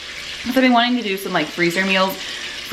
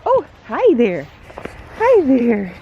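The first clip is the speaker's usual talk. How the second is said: higher, louder, slow greeting to a dog; talking to a dog; 4 semitones higher, 4 dB louder, slower than usual. Real, not acted. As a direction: coo hello at the neighbor's dog.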